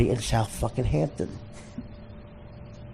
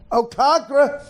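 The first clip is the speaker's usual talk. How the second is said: high, exclaimed caricature; mock greeting; 12 semitones higher than usual. Comic tests Gary's new nickname as a greeting, high mocking cry. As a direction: try the new name in the squealed voice. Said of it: "Doesn't sound the same".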